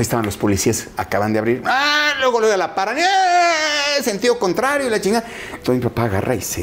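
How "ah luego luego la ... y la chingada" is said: grito agudo de susto fingido; susto imitado. Retold or imitated. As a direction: imita a la mamá asustada. (la mamá detenida por la policía, susto agudo imitado)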